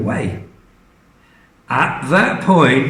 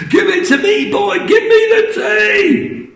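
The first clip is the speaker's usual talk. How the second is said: greedy shrieking Grandma; 3 dB louder than usual. Acted. Grandma grabbing the tea from George, very high greedy. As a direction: screech for the tea.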